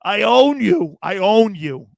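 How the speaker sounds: loud, cocky repeated taunt; loud mock boast; taunting fans. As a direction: gloat it right at the crowd. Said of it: "He's telling Bear fans".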